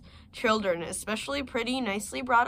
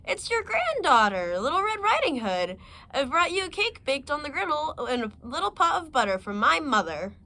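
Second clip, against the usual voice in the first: put-on higher, louder, bright child's call; a sweet child announcing herself; little girl voice; 7 semitones higher, 5 dB louder than usual. Girl at the door answers the wolf, high and eager.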